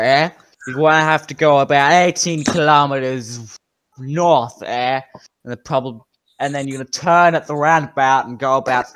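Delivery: Canadian bartender giving directions, loud and emphatic; Canadian accent. Acted. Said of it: "Why is he Canadian?".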